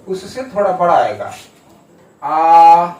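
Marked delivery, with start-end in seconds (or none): 2.2-2.9 आ held out long and loud at double length. Shown as a demonstration. Teacher demonstrating a long vowel to students, drawn-out आ. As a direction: stretch the vowel आ to twice a short one. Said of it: "इसमें दो गुना जो है sound लगता है".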